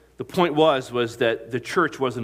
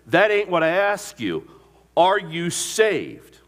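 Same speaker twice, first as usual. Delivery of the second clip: loud, higher, slowed retold demand, leaning on each word; insistent demand; 7 semitones higher, 7 dB louder, slower than usual. Crewman loudly pressing the recruit who won't answer, drawn-out demand. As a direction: push him hard for an answer, dragging out the last question.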